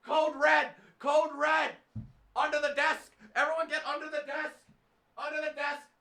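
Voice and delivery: high-pitched, hammed-up alarm calls; mock emergency alert. Viewers ordering everyone to take cover, mock panic. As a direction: sound a pretend code red, high and urgent. Acts out emergency drill over upload.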